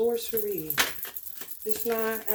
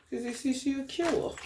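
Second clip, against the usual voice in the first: normal talking voice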